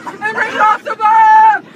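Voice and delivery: loud excited slow reveal. Real, not acted. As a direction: shout out the big twist. Story climax reveal.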